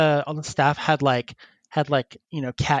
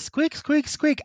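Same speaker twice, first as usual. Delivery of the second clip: high squeaks; 11 semitones higher, slower than usual. Retold or imitated. Imitating squeaker fur.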